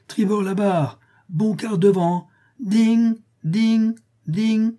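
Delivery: high-pitched commands and bell clangs; boy's pretend captain, loud chiming dings; play steamboat captain. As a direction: call out helm orders, then ring out the bell. Plays captain and ship's bell.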